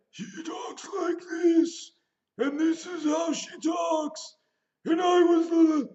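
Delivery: high, slow, strained shouted voice; imitating Lupita's evil character. Reviewing the movie, imitating the villain's eerie high voice. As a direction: croak it out high and eerie like the tethered double. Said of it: "Lupita's evil character is like".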